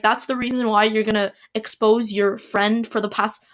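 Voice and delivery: ordinary talk, nothing marked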